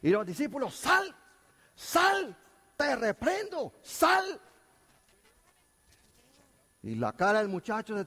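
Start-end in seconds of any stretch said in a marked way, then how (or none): 0.7-4.4 high, louder, frantic commands; desperate rebuke. Retold or imitated. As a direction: yell at the demon to get out. Discípulos reprenden al demonio. (reprensión desesperada, aguda y fuerte)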